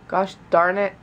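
ordinary talk

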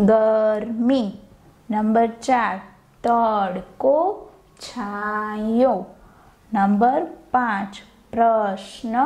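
each word slowly spaced for copying; teacher dictating word pairs; slow dictation. Shown as a demonstration. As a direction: read the answers out slowly for the class to write. Dictates antonym answers.

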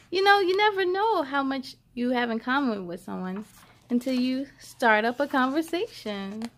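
plain reading voice

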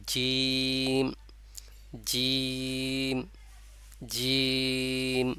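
জিম held long for three alifs, lips wide, not rounded; Arabic letter jeem drawn out. Shown as a demonstration. Teacher showing students the letter, জিম drawn out long. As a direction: stretch জিম slowly with the tongue middle touching the palate. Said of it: "যেমন".

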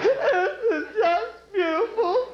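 high, slow weepy voice; hammed-up teary sobbing; mock crying. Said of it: "all the Catholics sit around and go".

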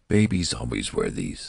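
low-pitched, prim reply. A narrator's reading. Nurse correcting the old newborn, prim. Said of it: "said the nurse primly".

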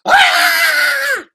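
loud, very high held scream; scream demo. Shown as a demonstration. Showing kids the swallowed scream, high shriek. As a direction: scream like you're being swallowed. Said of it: "I want you guys to go".

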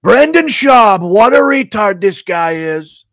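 high, slow, loud drawn-out impression; hammed-up, drawn-out Trump voice. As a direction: stretch it out loud and high like a blustering politician. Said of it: "[name] Trump. Describing Brendan Schaub impression".

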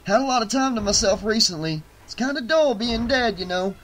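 high, quick, chatty voice; cheerful dead showman. Acted. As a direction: chat breezily about a dull afterlife. Plays Tony chatting about death.

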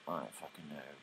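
low, soft, defeated; despairing. Retold or imitated. Olive answers Rue hopelessly, low and soft. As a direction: near tears, giving up. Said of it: "And then she just cries and cries".

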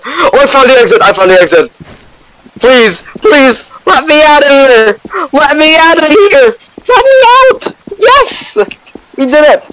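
shrieking high with relief; ecstatic screaming. Real, not acted. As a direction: scream for joy at the exit. Escaping monster in game.

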